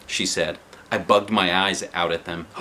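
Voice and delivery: usual speaking voice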